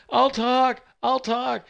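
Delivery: high panicked squeal; mock terror. Terrified accountant caving in, high mock panic. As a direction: beg like a scared little man.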